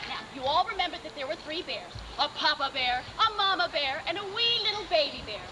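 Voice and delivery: usual speaking voice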